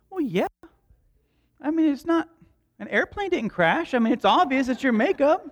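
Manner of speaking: high-pitched mock indignation; comic, incredulous dad retort; sarcastic exasperation. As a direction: state the obvious to your kid, voice way up. Exasperated dad retorts to daughter in story.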